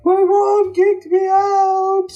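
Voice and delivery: high, slow mock-weeping girl voice; a high, drawn-out whine of a crying teen; imitating crying girl. Crying girl quoted at window.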